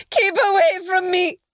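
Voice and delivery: high, loud terrified scream. A narrator's reading. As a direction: scream in terror. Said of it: "she screamed".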